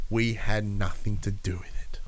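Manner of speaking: whispered mock confession, tossed off. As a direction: whisper it like a guilty aside. Mock quote of wrestler's apology.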